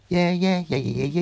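high, loud, squeaky chant of tiny voices; tiny shouting sand grains. Acted. The magic sand grains cheer at Daddy, high and loud. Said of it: "And the orange said".

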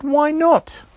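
loud, very high protest; high, indignant burst of a question; incredulous demand. Acted. Georgia demanding a reason from the straws, loud incredulous protest.

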